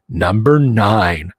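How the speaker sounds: low, slow announcer call; booming countdown announcer. Shown as a demonstration. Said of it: "Get my best announcer voice going on".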